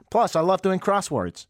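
hammed-up attempt at female Canadian speech; badly faked Canadian woman. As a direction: have a poor go at a Canadian woman. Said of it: "Definitely not like that".

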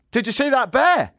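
high-pitched, urgent retold question. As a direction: call it out to a stranger, pitch jumping up. Acted urgent question to a stranger.

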